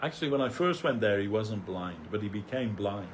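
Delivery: ordinary talk, nothing marked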